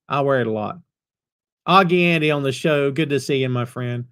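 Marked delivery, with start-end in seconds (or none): none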